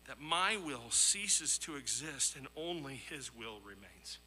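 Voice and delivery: usual speaking voice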